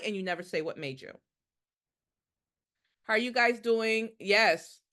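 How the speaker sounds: normal talking voice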